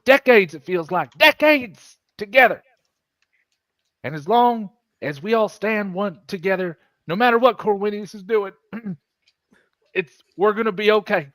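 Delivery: impassioned persuasion. Acted. Crewman rallying Naira to stand together, impassioned. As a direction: plead with feeling, leaning on the key words.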